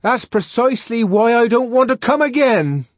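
high, loud exasperated refusal; exasperated full-volume retort; shouted retort. A narrator's reading. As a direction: yell back through the closed door. Shouted quarrel through locked door.

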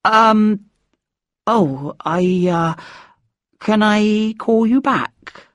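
hesitant, drawn-out fumbling; awkward mumble. A narrator's reading. Child on the phone with Dad, Mum nearby, awkward. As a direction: stall awkwardly through the words. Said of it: "I mumbled awkwardly".

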